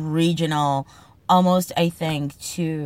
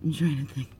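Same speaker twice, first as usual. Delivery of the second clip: usual speaking voice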